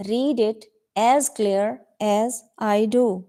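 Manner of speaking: slow, clear model reading. Shown as a demonstration. Teacher models the phrase slowly for her students. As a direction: spell it out slowly for the class. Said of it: "teacher repeats the model".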